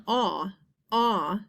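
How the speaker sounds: aw, aw stretched and open; isolated aw sounds. Shown as a demonstration. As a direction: repeat the vowel aw twice, drawn out. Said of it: "if you hear that sound".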